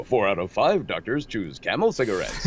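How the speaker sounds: put-on swooping old-time radio ad voice; old broadcast announcer. Quoting old cigarette ads, as a radio announcer. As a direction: sell it like a vintage radio pitchman. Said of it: "listening to old broadcasts".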